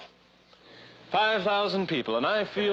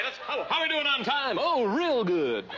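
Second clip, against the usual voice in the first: ordinary talk, nothing marked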